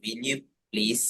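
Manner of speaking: soft, courteous request; politely. Shown as a demonstration. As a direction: ask it gently and politely. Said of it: "വളരെ polite ആയിട്ടാണ് ചോദിക്കുന്നത്".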